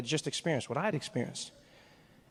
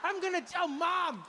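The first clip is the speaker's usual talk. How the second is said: put-on much higher child's voice, whiny French; upset little boy; 13 semitones higher than usual. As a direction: play a tearful kid scolding his papa. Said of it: "this kid was like".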